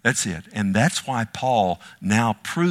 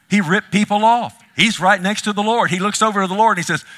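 loud, high and fast, pressing the point home; preaching climax; 10 semitones higher, 6 dB louder than usual. Real, not acted. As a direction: drive it hard up the scale.

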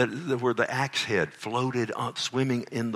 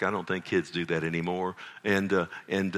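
normal talking voice

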